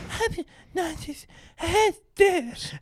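drawn-out, very high falsetto; slow, squeaky caricature of a woman; mock female impression. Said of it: "that's my impression of all women".

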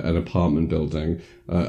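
ordinary talk

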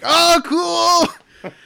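high falsetto gush of delight; excited high oh cool. Retold or imitated. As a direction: squeal it out, high and thrilled. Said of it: "I'm like".

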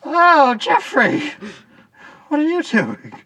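high, loud startled cry; acted surprise. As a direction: exclaim in hammed-up shock. Startled to meet Jeffrey.

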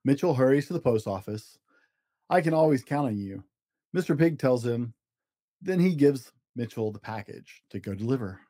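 gentle storybook narration with small character voices; reading to children. A narrator's reading. Dad reading a story to his kids, storybook voice. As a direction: read it like a bedtime picture book. Dad reads picture book aloud.

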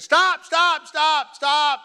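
high, loud cries of stop; begging the men to stop lifting him; desperate shout. Retold or imitated. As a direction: scream for them to stop. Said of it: "and I said".

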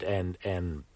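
ordinary delivery

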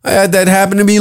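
usual speaking voice

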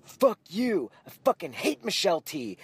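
furious, pounding the wall; angry outburst. Retold or imitated. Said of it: "one was like".

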